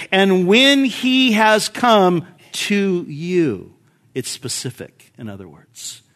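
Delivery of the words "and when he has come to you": slow, weighty "to you" at the end; stress on to you. Shown as a demonstration. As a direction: read it slowly, landing on "to you". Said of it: "we should read it in this way".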